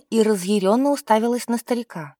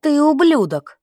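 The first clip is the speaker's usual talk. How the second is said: higher, indignant outburst; angry insult; 5 semitones higher, 4 dB louder than usual. A narrator's reading. Настя insults the man selling women, higher and angry. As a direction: hurl the insult at the old man.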